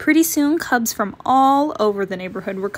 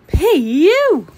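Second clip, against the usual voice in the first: mock disgusted; 7 semitones higher, 8 dB louder than usual. Warning about a smelly item.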